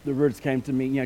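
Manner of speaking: ordinary delivery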